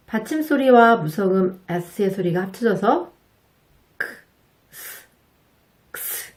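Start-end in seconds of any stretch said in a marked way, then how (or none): 4.0-6.3 크, 스, 크스 whispered almost silently, as a k then s; breathy 크 then 스, then joined into 크스; ks sound demo. Shown as a demonstration. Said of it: "이런 느낌으로 발음이 된답니다".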